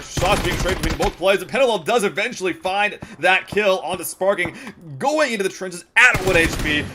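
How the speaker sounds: building excited esports commentary; live play-by-play. Acted. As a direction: call the fight, rising intensity. Caster calls live fight.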